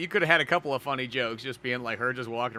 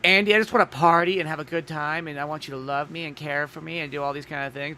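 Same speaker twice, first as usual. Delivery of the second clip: put-on higher-pitched, lightly goofy girlish plea; goofy Valley Girl; 4 semitones higher, 4 dB louder than usual. Mai telling Andy she wants to party, goofy Valley Girl. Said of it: "goofy Valley Girl".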